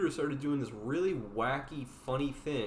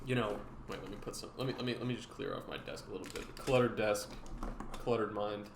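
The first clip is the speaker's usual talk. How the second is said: ordinary delivery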